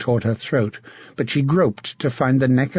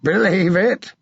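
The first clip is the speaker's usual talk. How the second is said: laughing; 7 semitones higher than usual. Acted. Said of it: "Sir John laughed".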